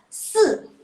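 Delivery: se punched high and loud, falling hard; fourth-tone demo. Shown as a demonstration. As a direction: snap se down from the top. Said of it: "Kalau nada keempat".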